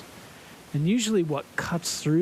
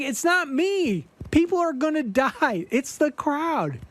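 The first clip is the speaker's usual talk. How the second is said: exasperated plea; 9 semitones higher than usual. Retold or imitated. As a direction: make him understand it's the crowd.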